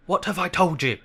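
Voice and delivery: high, loud angry outburst; thundering. Acted. Uncle scolding his nephew, thundering. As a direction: thunder it at the boy. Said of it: "thundered his uncle".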